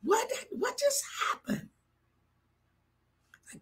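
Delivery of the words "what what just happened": high, hushed, winded gasp of words; out of breath. Retold or imitated. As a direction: retell it weak and breathless. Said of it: "I'm, I'm out of breath".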